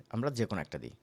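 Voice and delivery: ordinary talk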